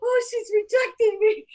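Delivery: high crying voice; remembering rejection with a cry in the voice; tearful hurt. Retold or imitated. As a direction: choke up as if rejected. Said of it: "Feeling, I was like".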